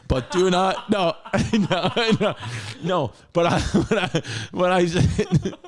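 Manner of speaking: laughing through the words. Real, not acted. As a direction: crack up while talking. Breaks up laughing mid-quote.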